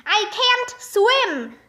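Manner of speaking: high, mildly panicked call; yelled. A narrator's reading. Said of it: "Mister Klutz yelled".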